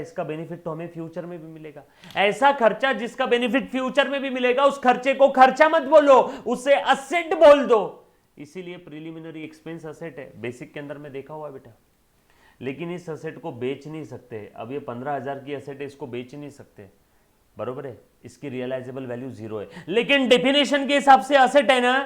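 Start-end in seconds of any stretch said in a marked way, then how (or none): 2.2-7.8 very loud, high-pitched classroom shout; full-voiced, high, insistent proclamation; emphatic shouting. Real, not acted. Teacher hammering home the key rule. 19.9-22.0 loud, high-pitched objection; sudden high, full-voiced insistence; insistent shout. Real, not acted. Teacher insisting on the definition.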